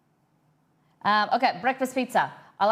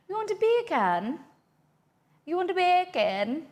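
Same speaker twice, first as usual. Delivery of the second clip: high, lilting mock Jamaican accent; hammed-up high-pitched Jamaican accent; 11 semitones higher than usual. Shown as a demonstration. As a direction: put on a playful Jamaican accent, much higher. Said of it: "sound like you're saying bacon in a Jamaican accent".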